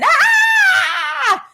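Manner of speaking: very high, loud mock scream; child's scream. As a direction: shriek like a hurt child. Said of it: "is screaming".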